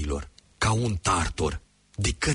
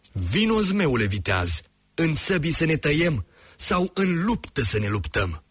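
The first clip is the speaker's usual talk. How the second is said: hero's challenge, rising higher at the end; calling out; 7 semitones higher than usual. A narrator's reading. Greuceanu challenging the dragon from the bridge, calling out. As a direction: call him out boldly from the bridge. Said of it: "ieși pe pod și strigă".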